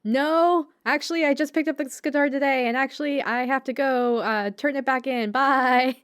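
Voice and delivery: flustered Bocchi. Retold or imitated. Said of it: "Bocchi's like".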